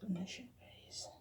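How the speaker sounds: soft whispered reveal. Real, not acted. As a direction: breathe it out as a whisper. Quiet reveal of the dish.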